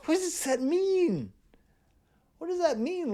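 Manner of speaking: high, slow incredulous question; baffled. Real, not acted. Baffled by a lyric.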